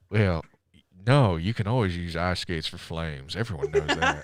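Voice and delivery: a hint of a low impersonation; Nicolas Cage. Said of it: "That's what he would respond with".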